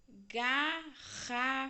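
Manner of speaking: "garrafa" esticada bem devagar; drawn-out reveal. Shown as a demonstration. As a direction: revele a palavra lentamente. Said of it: "Que palavra será que é essa?". (garrafa dita bem devagar)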